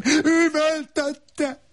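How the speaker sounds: very slow, high-pitched scream; long, high howl; howled narration. A narrator's reading. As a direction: scream the narrator's word, drawn right out. Narration tag screamed at the climax.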